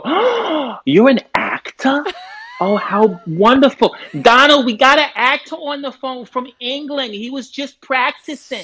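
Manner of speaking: high, hammed-up American delight; gasping, high-pitched mock excitement, calling out to Donald; thrilled elderly American. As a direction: gush like a star-struck old American. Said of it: "And they'd go".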